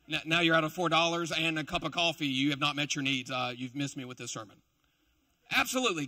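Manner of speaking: ordinary talk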